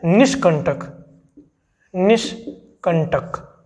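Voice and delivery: निष्कंटक stretched very slowly, syllables apart; slow correct word. Shown as a demonstration. Teacher dictating निष्कंटक slowly to students. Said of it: "ये शुद्ध शब्द है".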